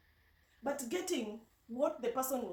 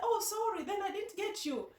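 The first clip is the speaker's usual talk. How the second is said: sheepish apology; 9 semitones higher than usual. Retold or imitated. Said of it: "Then I said".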